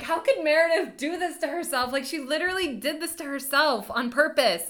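high-pitched retold outrage; acting out a distressed, incredulous reaction; dismayed disbelief. Retelling upset reaction while crying.

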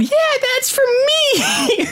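very high, drawn-out cry; squealed crowd heckle; heckler from the crowd. Acted. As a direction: call out from the crowd, high and stretched. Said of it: "you just hear from the crowd".

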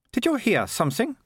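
put-on high-pitched questioning girl; high, wondering character voice; girl's voice. A narrator's reading. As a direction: voice an uneasy girl, pitched well up. Startled girl character in danger.

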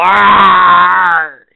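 a loud hammed-up growl; mock monster roar. Host voicing a clawed tortoise, loud mock roar. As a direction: roar like a spiky-tailed beast.

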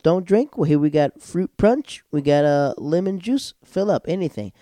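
high, loud insistent offering; imitated eager host. Host offers juice to a non-drinker, eagerly insistent.

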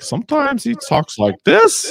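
high-pitched mimicry of his inflection; Jordan impression. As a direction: put on an exaggerated high voice. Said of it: "his inflection on his words is just like".

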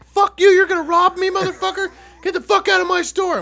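high, loud mock screaming woman; enraged manager. Manager yelling at the joking customers, hammed-up high and loud. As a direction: scream it like she's losing it. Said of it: "This bitch loses her mind".

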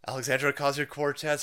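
ordinary delivery

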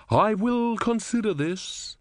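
high, slow, distant goddess voice; aloof Sea Queen. A narrator's reading. Sea Queen leaving Om waiting, aloof and slow. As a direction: dismiss him as she sinks away.

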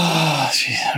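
sighing whisper of disbelief. Real, not acted. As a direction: breathe it out, exasperated. Sigh at her remedy.

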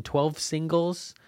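usual speaking voice

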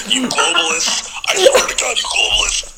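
high, airy ranting at you globalists; mock conspiracy ranter.

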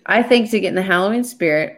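ordinary delivery

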